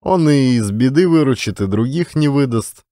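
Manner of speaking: ordinary delivery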